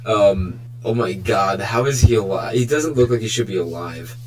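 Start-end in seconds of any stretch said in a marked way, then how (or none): none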